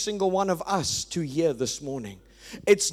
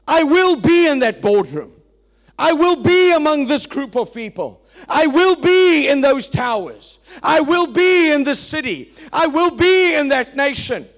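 forceful, loud preaching, each line hammered home; loud declaration; 6 semitones higher, 9 dB louder than usual. Acted. Preacher urging the congregation to declare it, loud and forceful. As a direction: proclaim it like a vow from the pulpit.

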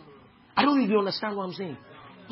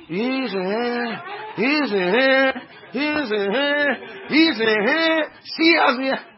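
high, drawn-out wail, climbing louder; mimicked lament; 6 semitones higher, 6 dB louder, slower than usual. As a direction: stretch each repeat into a bigger complaint. Mimicking people's drawn-out complaints.

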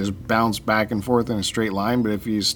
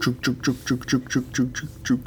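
train chugging; 5 dB quieter than usual. Pretend. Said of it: "That's the excuse train".

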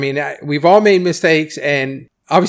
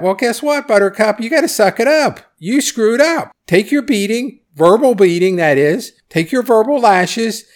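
higher, sarcastic lecture; sarcastic scolding; 6 semitones higher than usual. Acted. Lecturing the deputy who got yelled at, sarcastic. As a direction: talk down to him like a spoiled kid.

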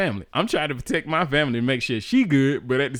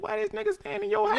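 much higher, soft incredulous squeak; 6 dB quieter than usual. Retold or imitated. Him wondering why the boyfriend lives there, high incredulous. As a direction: squeak it in disbelief.

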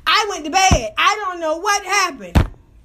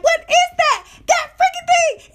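much higher, louder disbelief; high incredulous shriek through a laugh; shocked squeal; 4 dB louder than usual. Retold or imitated. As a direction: squeal at the sight. Retold shocked reaction.

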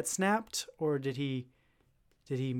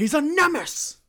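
loud high yell; barking newspaper editor; 12 semitones higher, 8 dB louder than usual. Retold or imitated. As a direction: bellow the accusation. Continuing the Jameson bit.

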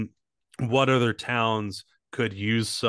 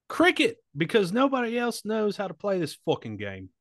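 put-on high-pitched, botched South African accent; bad South African accent; 9 semitones higher than usual. Comic advertising South Africa to aliens, badly faked accent. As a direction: attempt South African and miss toward British. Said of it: "South Africa".

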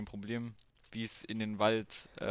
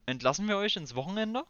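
ordinary talk

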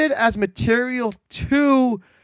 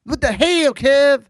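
a hint of an exasperated podcaster, a touch higher; light mock rant at Kev; lightly voiced podcaster griping; Chris Vernon impression; 5 semitones higher, 4 dB louder, slower than usual. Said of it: "if you think I sound like Chris Vernon".

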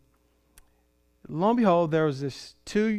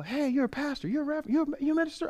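surprised greeting; 12 semitones higher, faster than usual. Retold or imitated. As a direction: imitate friends puzzling out the news. Retold surprised greeting from old friends.

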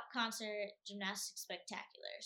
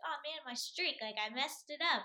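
higher mock lament over a small disaster; sarcastic; 6 semitones higher, 5 dB louder than usual. Gymnast recalling her messed-up Olympic routine, sarcastic mock dismay. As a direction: play up the disappointment as a joke. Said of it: "sarcastic about it".

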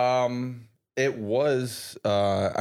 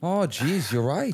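put-on Minnesotan accent; hammed-up Midwest accent; Fargo accent; 4 semitones higher, slower than usual. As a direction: concede like a Fargo local. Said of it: "that Fargo accent is a lot of fun".

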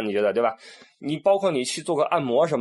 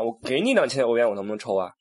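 normal talking voice

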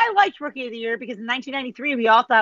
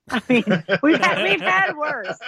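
laughing; 3 semitones higher than usual. Real, not acted. Laughing while joking about Browns.

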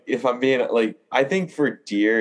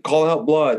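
higher, firmer instruction; mock-firm call-out order; lightly voiced hunting partner; retold command; 7 semitones higher, 3 dB louder than usual.

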